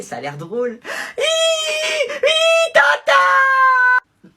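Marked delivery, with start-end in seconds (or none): 1.2-4.3 high wailing cry; loud fake wail. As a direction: bawl like a toddler. Kid wailing for auntie.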